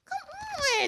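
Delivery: very high drawn-out "come on"; put-on high exasperation; exasperated squeak. Exasperated plea.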